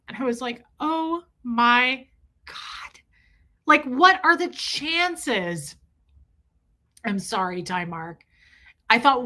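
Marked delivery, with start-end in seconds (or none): 0.8-5.7 stunned disbelief. Retold or imitated. Retelling amazement at dream.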